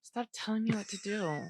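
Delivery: usual speaking voice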